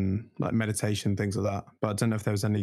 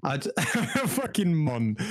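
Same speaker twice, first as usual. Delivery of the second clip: real laughter while talking, voice pitched up; laughing through words; 5 semitones higher, slower than usual. Real, not acted. Teasing a friend's accent while laughing. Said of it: "with a bit J-, Jamaican at the end".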